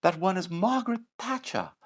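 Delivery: lofty mock Margaret Thatcher English accent; high plummy English lady accent; Thatcher impression. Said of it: "I wouldn't use that accent".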